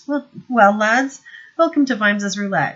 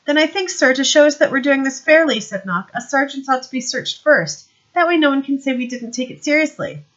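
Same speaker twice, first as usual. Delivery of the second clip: higher, louder smirking sergeant; Knock's voice; 7 semitones higher, 7 dB louder than usual. Acted. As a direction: play the sly sergeant, pleased with himself. Knock insists on being searched.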